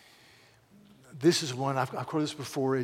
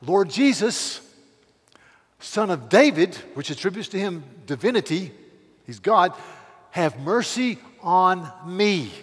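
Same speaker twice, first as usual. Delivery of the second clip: mock scream; 4 semitones higher, 9 dB louder than usual. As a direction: shout for mercy from the roadside. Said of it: "he starts screaming with all of his might".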